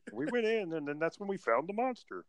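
high-pitched puppet-frog imitation; Kermit the Frog. Podcaster mimicking a TV monster hunter's story, Kermit-like voice. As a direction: tell it in a high, comic frog voice. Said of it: "sounded a little bit like Kermit the Frog".

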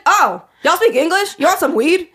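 a hint of the weed seller switching to English; mimicking street dealer. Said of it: "talking like that".

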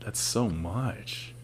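very soft and drawn out; quiet disbelief. Real, not acted. Host reacting to an absurd girth, quiet disbelief. Reacts to size, first take.

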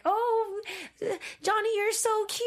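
put-on sing-song baby-cooing squeal; gushing over a cute kid. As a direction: gush adoringly over a little one. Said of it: "I'm like".

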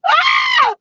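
sudden high-pitched scream; sharp high yelp. Real, not acted. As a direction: let out a quick startled shriek. Sudden cry out.

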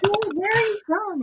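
very high, loud, drawn-out mock astonishment; high-pitched, slow, incredulous mimicry; astonished woman customer-service imitation. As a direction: mimic a stunned woman, stretched out and shrieky high. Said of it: "this woman was like".

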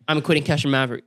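mock-serious low announcement; retold quitting. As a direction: imitate his grave resignation, pitched low. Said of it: "one time he was like".

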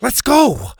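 high, alarmed call to run; urgent shout. A narrator's reading. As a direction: cry out to get moving now. Boy flees with dog.

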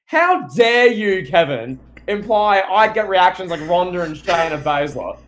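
mock outrage. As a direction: play the offended diva, drawing out how dare you. Mock outrage at co-host's comparison.

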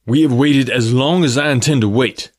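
rough angry demand. A narrator's reading. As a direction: bark out his impatience. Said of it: "he said roughly".

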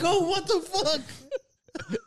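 very high, drawn-out shocked cry through laughter; startled exclamation. Retold or imitated. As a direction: retell being jolted, half laughing. Reacting to child's sudden scream.

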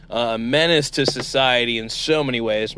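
ordinary delivery

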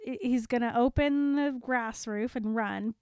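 normal talking voice

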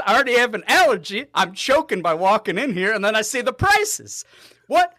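complaining to the cohost about peanuts and prices; worked-up rant. Real, not acted. Ranting about the restaurant.

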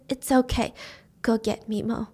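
soft, failing voice; weak reassurance. Retold or imitated. Wounded grandma to her daughter, quiet reassurance. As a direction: murmur it softly to reassure.

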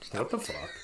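whispered, baffled curse; stunned whisper. Acted. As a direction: whisper it under your breath in disbelief. Stunned ranger as radio dies.

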